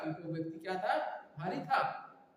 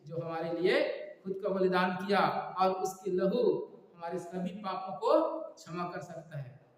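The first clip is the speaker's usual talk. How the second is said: ordinary talk, nothing marked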